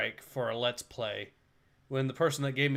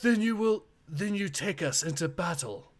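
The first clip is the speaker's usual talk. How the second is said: a hint of a creaky old man; higher, lightly voiced elder; 5 semitones higher than usual. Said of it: "That sounds like an old man voice".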